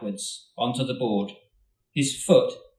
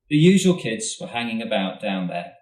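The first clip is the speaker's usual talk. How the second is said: plain reading voice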